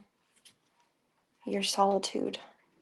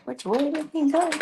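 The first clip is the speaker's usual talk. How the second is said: high playful voice; 6 semitones higher, 3 dB louder than usual. Real, not acted. Repeated in cat voice.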